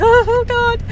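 high-pitched retold panic; mock-panicked, high exclamation; freaking out. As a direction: act out freaking out, voice jumping up. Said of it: "freaking out".

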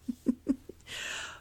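airy little laugh; high breathy giggle. Acted.

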